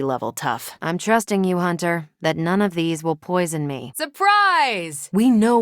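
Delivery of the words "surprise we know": high, loud burst of Surprise; spring it on them gleefully; bright party reveal shout; excited surprise. Acted.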